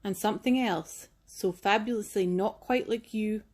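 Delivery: put-on gentle storybook narration for kids; reading to children. A narrator's reading. Reads picture book to children.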